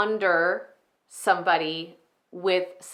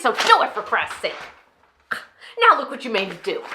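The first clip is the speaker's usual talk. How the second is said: irritated, raised voice; exasperated blame; 3 semitones higher, 4 dB louder than usual. Acted. Enid blames her executive pastor, exasperated. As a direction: blame him for your own outburst. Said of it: "how insane they can sound at times".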